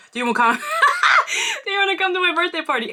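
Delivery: laughing through a squeaky, fast invitation; giggly mock invite. Fan pretending to invite [name] to her party, laughing and rushed. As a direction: breaks into a shrieking laugh mid-question, then rushes on.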